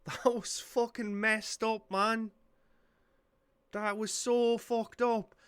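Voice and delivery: slow, drawn-out amazement; stunned awe. Real, not acted. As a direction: let the shock sink in slowly. Stunned after brutal fight.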